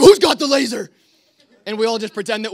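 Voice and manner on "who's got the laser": loud, high-pitched angry demand; retold yell. Night watchman confronts boys pretending to sleep, high retold yell. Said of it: "if he just yells at us".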